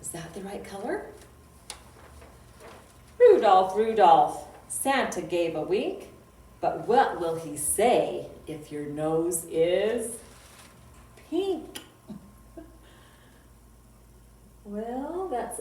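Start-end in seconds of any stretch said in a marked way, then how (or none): 3.2-11.8 loud, sing-song poem reading; children's poem recital. Acted. Librarian reciting a reindeer poem to kids, loud sing-song. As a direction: chant the rhyme out for little listeners.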